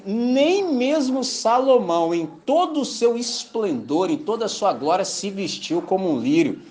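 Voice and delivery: preacher quoting Jesus to the congregation, slow and solemn; solemn scripture quote. Acted. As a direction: stretch each phrase out like a proclamation. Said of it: "Jesus fala assim".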